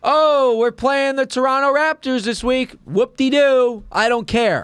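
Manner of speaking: high, slow dismissive whoop-de-doo; mock indifference. Mocking bored fan attitude.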